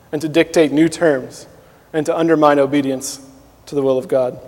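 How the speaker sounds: ordinary talk, nothing marked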